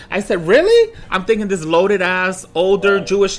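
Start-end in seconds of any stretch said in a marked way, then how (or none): 0.5-0.9 high, loud squeaked Really; stunned disbelief. Retold or imitated. Retelling his shock at the lady. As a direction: shoot up in shock.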